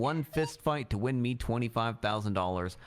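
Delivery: reading his own crime story aloud; story narration. Acted. Reading his crime book aloud to the court, in a story voice. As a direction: narrate it like an audiobook. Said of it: "you should narrate books".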